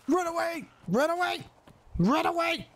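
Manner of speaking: high-pitched cries of alarm; panicked cry. Acted. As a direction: squeal it in short bursts as you flee. Fleeing danger in the game.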